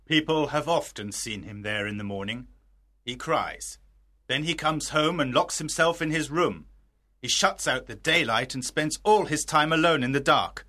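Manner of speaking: a father quietly confiding his fears; hushed paternal concern; worried father. Acted. Father worries about his son.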